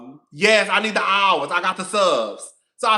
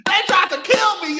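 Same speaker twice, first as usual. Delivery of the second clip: defiant shout; 8 semitones higher, 3 dB louder than usual. Real, not acted. Defiant rant about losing her page.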